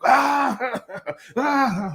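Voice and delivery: high-pitched imitation of a crowd roaring; imitated party laughter from the next room; mock uproarious laughter. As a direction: burst into a high, hammed-up laugh. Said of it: "this uproarious laughter starts".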